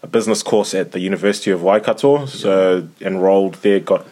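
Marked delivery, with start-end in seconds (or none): none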